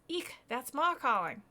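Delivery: high, loud, drawn-out alarm; startled boy. Acted. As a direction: react with a mock-startled yelp. Boy startled by mother's call.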